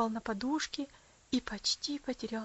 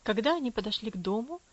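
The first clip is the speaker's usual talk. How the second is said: plain reading voice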